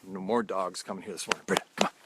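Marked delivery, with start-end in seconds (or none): 1.4-1.9 raised voice summoning a dog across the yard; sharp call to a wandering dog. Real, not acted. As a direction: call "Britta" out louder to the dog. Calling the dog mid-talk.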